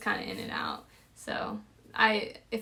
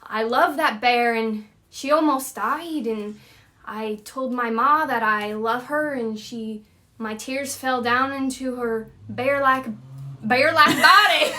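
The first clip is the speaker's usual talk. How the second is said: loud, hammed-up Scottish storytelling; Scottish like a character from Brave; Scottish accent; 2 semitones higher, 7 dB louder than usual. Shown as a demonstration. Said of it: "think of Brave".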